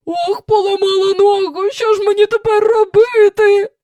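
high lamenting wail. A narrator's reading. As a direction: moan it out like a howl. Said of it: "заголосила вона".

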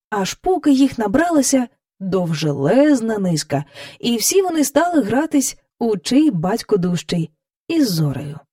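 plain reading voice

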